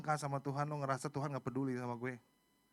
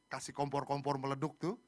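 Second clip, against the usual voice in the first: ordinary delivery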